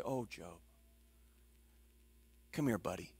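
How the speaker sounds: God mock-consoling Job, hushed and gentle; soft consoling God. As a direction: coo it like a mock comforter. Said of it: "He doesn't come to Job and say".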